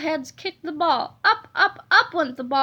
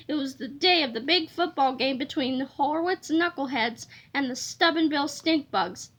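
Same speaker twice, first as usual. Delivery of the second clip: plain reading voice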